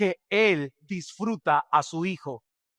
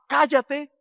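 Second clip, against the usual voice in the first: put-on child yelling; 7 semitones higher than usual. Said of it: "que le gritan a sus padres".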